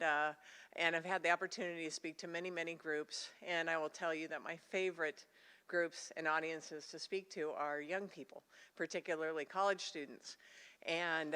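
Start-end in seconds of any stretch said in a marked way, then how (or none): none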